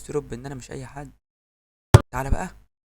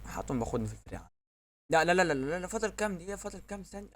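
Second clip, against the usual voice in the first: usual speaking voice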